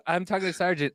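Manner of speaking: ordinary talk, nothing marked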